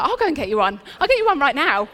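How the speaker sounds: bursting with joy to help; delighted. Retold or imitated. Nurse answering the boy's Bible request, excited and laughing.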